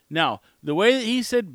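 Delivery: usual speaking voice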